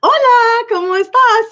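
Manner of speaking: high, loud eager Spanish greeting; bright tourist Spanish; showing-off Spanish. Retold or imitated. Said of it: "I hate that when I do that in public".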